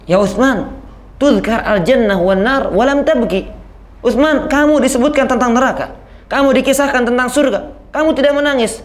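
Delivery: retold companions' puzzled questioning, pitched high; insistent questioning. Companions ask Utsman why the grave makes him weep, insistent high-pitched questioning.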